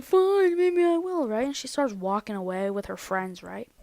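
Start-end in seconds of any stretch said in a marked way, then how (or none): 0.0-1.3 high, loud mock huff; hammed-up indignant comeback; defiant retort. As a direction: imitate storming off in a quarrel. Retold quarrel, defiant reply.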